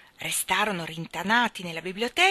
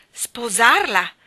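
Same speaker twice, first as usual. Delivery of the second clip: slow, much louder exasperated echo; exasperated exclaim; 4 semitones higher, 10 dB louder, much slower than usual. Acted. Jim hears he must marry Nora, exasperated. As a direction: fling the word back in disbelief. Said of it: "esclamò Jim esasperato".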